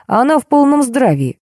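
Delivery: high, lifted announcement; pleased exclamation. A narrator's reading. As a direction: declare it with relieved cheer. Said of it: "воскликнул Дегтярев".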